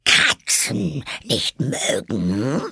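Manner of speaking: high, drawn-out indignant screech; screeching, furious mouse. A narrator's reading. The mouse throws Alice's words back, screeching furiously. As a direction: stretch each word into an outraged question. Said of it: "schrie die Maus mit kreischender, wütender Stimme".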